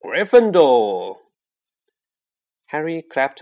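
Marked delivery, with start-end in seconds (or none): 0.0-1.2 slow, louder drawn-out call; sorting hat announcing Ron's house; hat shouts. Acted. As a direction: boom it out to the hall. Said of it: "the hat had shouted".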